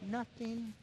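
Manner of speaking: put-on tiny, barely audible child's answer; sheepish denial. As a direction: mumble it, caught red-handed. Caught child denies it.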